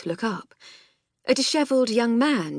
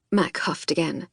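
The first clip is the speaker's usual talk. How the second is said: ordinary delivery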